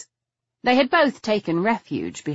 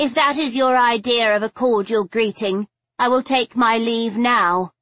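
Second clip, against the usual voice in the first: high, affronted lady's voice; haughty lady caller; 6 semitones higher, 4 dB louder than usual. A narrator's reading. Offended lady threatens to leave her hosts, haughty. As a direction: stand on your dignity, offended.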